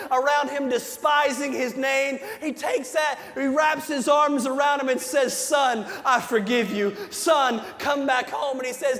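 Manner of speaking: preaching at full force. Acted. Pastor retelling the prodigal son to his church, shouting with fervor. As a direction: preach it big, like you're lifting the whole room.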